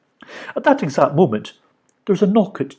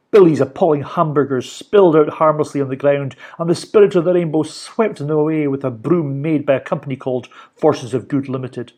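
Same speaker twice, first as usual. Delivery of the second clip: ordinary delivery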